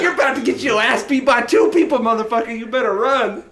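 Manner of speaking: high, loud taunt broken by laughter; laughing threat. Retold or imitated. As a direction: chase him off while half laughing. Chasing the man, laughing threat.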